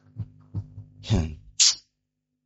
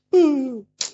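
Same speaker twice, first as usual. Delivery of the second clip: a high, put-on sigh of worry; imitating a worried sigh; high mock sigh. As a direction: sigh like a lost soul. Worried member sighs.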